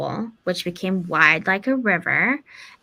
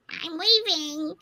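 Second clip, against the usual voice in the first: high, slow, innocent voice; slow high little fake-girl voice; cat faking the girl; 9 semitones higher, 6 dB quieter, slower than usual. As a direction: answer sweetly, pretending to be the girl. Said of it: "but the cat, like".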